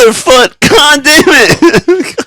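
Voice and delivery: high, loud, drawn-out cursing; reenacted screaming frustration; anguished yell. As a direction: howl the curse in mock despair. Retold second step in puke.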